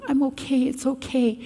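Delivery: soothing. Retold or imitated. Reassuring frightened children.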